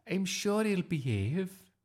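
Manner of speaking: slow, high teacher voice; uneasy reassurance. A narrator's reading. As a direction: reassure doubtfully in a high-pitched voice. Teacher reassures about the dog.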